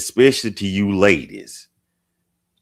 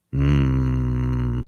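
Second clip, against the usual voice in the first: long, low "hmm"; deep hum; 6 semitones lower than usual. Shown as a demonstration. Host showing off a deep voice, deep hum.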